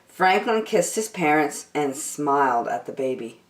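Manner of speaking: usual speaking voice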